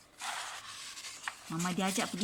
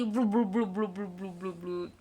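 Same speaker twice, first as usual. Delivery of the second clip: fading bubbling. Pretend. Mother shows elephant underwater, bubbles fading out. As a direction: let the bubbles sink away.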